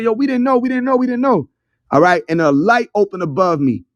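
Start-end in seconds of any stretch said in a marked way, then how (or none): 0.0-1.5 high-pitched mock pleading; repeated high, lightly acted wail; imitated crying. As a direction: imitate an overwhelmed crowd, pitch raised. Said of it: "they was crying".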